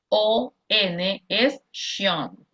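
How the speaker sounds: slow O, N es tion with tion as sion; teacher slowly explaining the tion ending; spelled rule, slow. Shown as a demonstration. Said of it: "toda palabra que termina con T, I, O, N".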